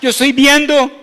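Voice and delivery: loud preached quote of God; voicing the Lord. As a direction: proclaim it as the Lord's own words. Preacher voices the Lord speaking.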